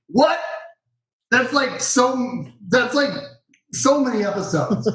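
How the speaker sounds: high amazed disbelief; wow, that many?; surprised. Real, not acted. Amazed at episode count.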